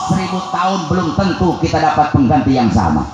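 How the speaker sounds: fiery preacher building to a climax; preaching at full force. Acted. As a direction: build the litany of the dead to a cry. Preacher's rising list of the dead.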